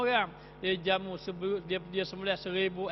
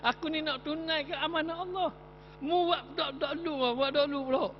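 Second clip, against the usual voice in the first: high, scolding voice; angry at the knife; 9 semitones higher than usual. Acted. [name] scolding the blunt knife in a high indignant voice. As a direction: tell off the knife, pitched up. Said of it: "dia marah ke pisau tu".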